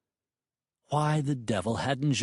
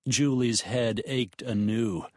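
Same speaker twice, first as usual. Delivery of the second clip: usual speaking voice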